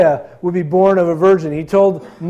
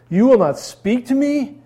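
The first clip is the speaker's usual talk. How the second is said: Pilate demanding; 6 semitones higher, 4 dB louder than usual. Acted. Pilate questioning silent Jesus.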